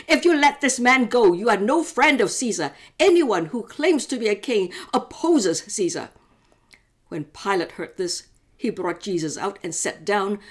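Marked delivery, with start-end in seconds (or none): 0.1-6.1 louder, higher shout; leaders shouting. Acted. Leaders to Pilate, a loud threatening shout. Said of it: "kept shouting".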